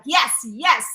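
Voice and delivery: loud, slow, emphatic rallying cry; fired-up urging. Retold or imitated. Host voicing what the author is like, fired-up urging. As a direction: imitate an author urging everyone on. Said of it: "look, he's like".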